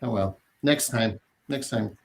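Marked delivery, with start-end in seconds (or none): none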